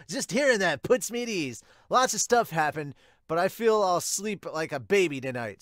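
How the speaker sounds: put-on high, loud eager boy voice; loud cheerful kid bursting into the room; excitable Sawamura. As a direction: burst in bright and keen as the hyper rookie. Sawamura chatters before bed.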